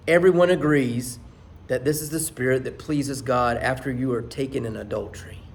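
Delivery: usual speaking voice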